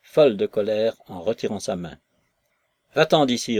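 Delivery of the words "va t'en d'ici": loud, higher push away; angry dismissal. Acted. Maslova orders Nekhludov away, loud and angry. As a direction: drive him out with it. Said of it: "reprit-elle".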